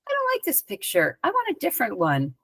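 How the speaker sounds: high, soft little voice for a thought; small, high-pitched musing to oneself; voiced inner thought. Acting out her inner thought.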